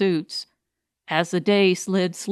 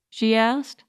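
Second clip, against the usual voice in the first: plain reading voice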